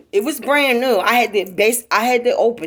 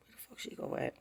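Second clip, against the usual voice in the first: whispered to herself; soft private whisper; 21 dB quieter, much faster than usual. Retold or imitated. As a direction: breathe it under your breath, puzzled. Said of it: "Like, to myself".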